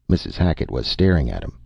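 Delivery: ordinary delivery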